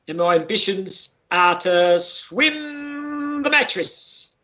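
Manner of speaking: ordinary talk, nothing marked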